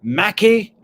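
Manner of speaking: teasing imitation of someone unsure of a name; lawyer fumbling a name. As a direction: mockingly try out wrong names. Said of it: "She just can't get his name right".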